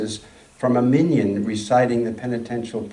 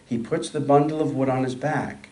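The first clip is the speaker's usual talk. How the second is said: ordinary delivery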